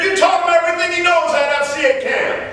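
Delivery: high, shouted retold praise; elated father. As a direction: call it out like a thrilled dad. Retold elated father crediting the coach.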